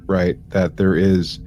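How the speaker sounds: ordinary delivery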